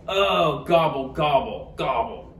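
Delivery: understated sigh, sinking in pitch; sighed gobble. A narrator's reading. Turkey almost out of time, sinking sigh. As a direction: let it sink like a mild sigh. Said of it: "Turkey sighed".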